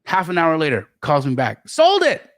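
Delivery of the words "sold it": high, proud quick report; excited news. Retold or imitated. Josiah calling back excited.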